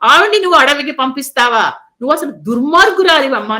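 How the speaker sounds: a son's outraged reproach, voiced loud; retold angry rebuke. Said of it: "ఎంత కదిలిపోయాడండి".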